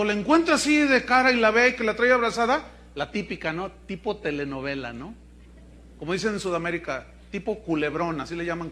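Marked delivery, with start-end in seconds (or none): none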